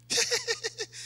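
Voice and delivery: light high-pitched chuckle; high fake laugh. Shown as a demonstration. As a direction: demonstrate a little laugh. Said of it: "let out a few laughter".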